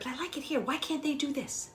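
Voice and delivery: frustrated whine. Retold or imitated. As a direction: whine that they won't keep up. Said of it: "that gets frustrating".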